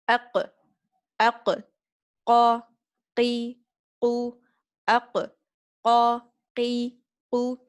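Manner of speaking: loud, heavy qaf in aq, qa, qi, qu; heavy qaf drill. Shown as a demonstration. Teacher drilling the heavy qaf for students, loud and heavy. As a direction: hit each qaf thick from the throat. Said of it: "you have to pronounce it heavily".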